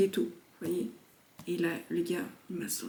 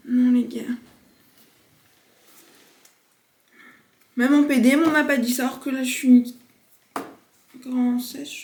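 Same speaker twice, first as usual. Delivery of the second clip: loud, indignant complaint; loud exasperated rant; exasperated loud protest; 4 semitones higher, 9 dB louder than usual. Real, not acted. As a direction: protest it loudly, fed up. Exasperated outburst to friends.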